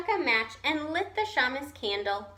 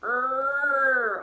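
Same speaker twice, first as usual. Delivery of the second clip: louder bear grunt; growl; 4 dB louder than usual. A narrator's reading. Old Bear growls his answer about jam, a grunt. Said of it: "Old Bear growled".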